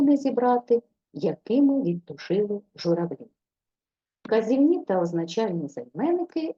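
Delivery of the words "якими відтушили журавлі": ordinary talk, nothing marked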